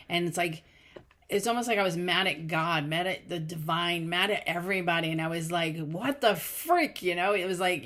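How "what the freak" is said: higher, drawn-out exasperation; exasperated retold outburst. Woman mad at God vents, exasperated retold outburst. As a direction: stretch it out in mock frustration. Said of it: "you just wanna scream".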